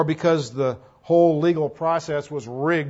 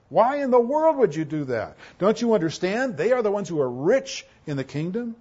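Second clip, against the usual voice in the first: higher, louder, indignant questioning; incredulous rebuke; 6 semitones higher, 3 dB louder than usual. Acted. Preacher voices James challenging his readers, incredulous rebuke. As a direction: scold them in disbelief, pitched up.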